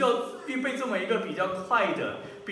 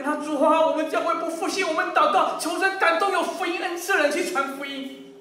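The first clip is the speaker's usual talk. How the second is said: high-pitched, loud mock prayer; mock pious plea; 7 semitones higher, 4 dB louder than usual. Preacher mimics members praying for someone to evangelize, mock pious. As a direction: plead to God with put-on fervour.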